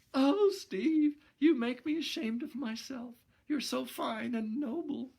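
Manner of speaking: put-on high, tearful girlish outburst. Gloria crying to Steve that he is noble, high tearful voice. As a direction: cry out in shame and admiration. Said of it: "she started crying".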